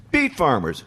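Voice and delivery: high, sneering heckler voice; mock them from the crowd; sing-song put-down; mocking heckle. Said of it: "And one girl goes".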